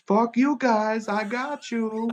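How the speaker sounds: mock triumph. Tour manager finally pranking the band, high slow gloating. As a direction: crow over finally winning.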